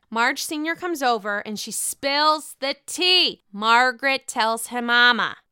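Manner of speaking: usual speaking voice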